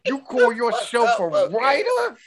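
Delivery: high mock lecturing voice; teasing a friend with a mock lecture; mock scolding. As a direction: talk down to him in jest.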